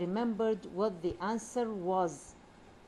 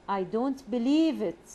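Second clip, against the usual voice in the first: louder, with a big swooping rise-fall on "believe"; exaggerated moan; 2 semitones higher, 6 dB louder than usual. Shown as a demonstration. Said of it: "You see the tone, the rise and fall".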